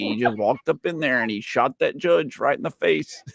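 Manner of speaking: put-on folksy Canadian accent. Shown as a demonstration. Retelling the shooting for laughs, Canadian accent. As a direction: finish the gossip as a chatty Canadian. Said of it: "Or you can do a Canadian accent".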